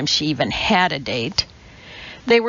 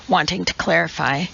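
ordinary delivery, nothing marked

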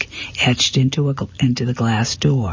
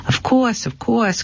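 eager; 10 semitones higher than usual. A narrator's reading. Eager shopkeeper reply.

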